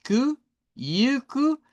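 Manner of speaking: "Ku, yuku" built up slowly and high, ku alone then joined to yu; segunda sílaba. Shown as a demonstration. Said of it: "La K y la U es la segunda". («Ku... yuku», lento y agudo)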